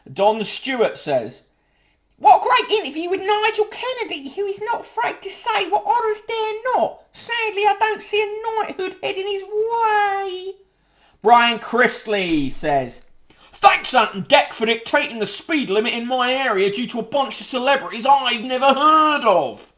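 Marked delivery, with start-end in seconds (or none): 2.2-10.6 much higher, thin put-on voice, sighing at the end; mock wistful reader. Host mocking a [name] fan's letter, high put-on whine. 13.5-19.7 higher, louder put-on grumble; mock grumpy reader. Host sending up a reader's speed-limit gripe, hammed-up grumble.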